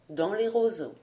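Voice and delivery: ordinary delivery, nothing marked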